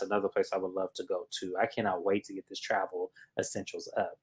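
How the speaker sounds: breathy whispered aside; whispering. Real, not acted. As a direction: whisper it under your breath.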